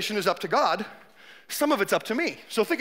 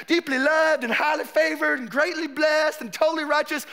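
mock Southern revival declaration, raised pitch; Southern accent; 3 semitones higher than usual. Preacher mimicking a believer's prayer, put-on Southern accent. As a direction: imitate a fervent Southern believer. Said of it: "I'm using Southern accent".

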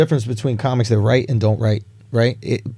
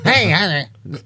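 Chappelle-style greeting; 7 semitones higher than usual. Retold or imitated. Mimicking how Chappelle says hey, in his cadence. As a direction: call out like Chappelle greeting the crowd. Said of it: "That's not an accent".

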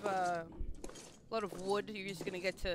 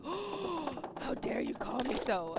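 mock offended whisper; 5 semitones higher, much slower than usual. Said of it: "and he's just like".

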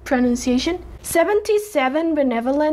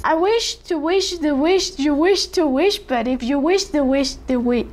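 ordinary delivery

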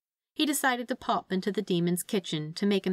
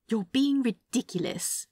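higher, slow exasperated dismissal; eye-rolling; 5 semitones higher than usual. A narrator's reading. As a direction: sigh it out, unimpressed. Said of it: "rolling his eyes at the demon".

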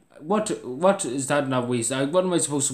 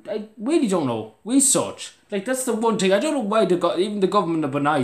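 much higher, louder exasperation; exasperated outburst; 8 semitones higher, 5 dB louder than usual. Real, not acted. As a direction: burst out in disbelief. Ranting at hate comment.

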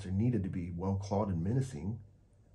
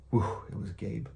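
usual speaking voice

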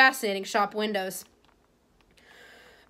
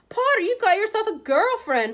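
much higher, mocking sneer; drawled taunt; 11 semitones higher, 4 dB louder than usual. Schoolboy bully mocks Harry about Ginny, a high mocking taunt. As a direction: taunt him with a smirk. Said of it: "drawled Malfoy".

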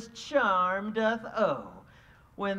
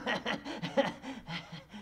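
mischievous laugh; 8 dB quieter than usual. Acted. Puck laughs over sleepers.

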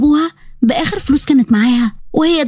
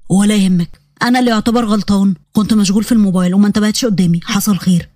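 put-on lower, easygoing young man brushing off an apology; Yazan's calm male voice; 6 semitones lower than usual. Yazan apologizing to Huda after bumping her, low and easy. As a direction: drop into a low relaxed male voice.

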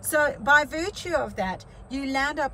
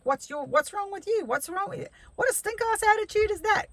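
imagined quarrel in a higher-pitched voice; imitated angry rant; 10 semitones higher than usual. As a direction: fire it back at a rude stranger, higher and higher. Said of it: "We could have had a full-on bull".

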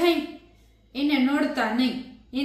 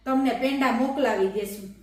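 usual speaking voice

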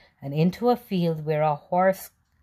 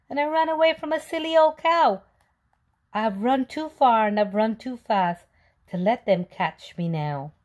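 worried cookie; 6 semitones higher, 4 dB louder than usual. Acted. Cookie tells fox his worry.

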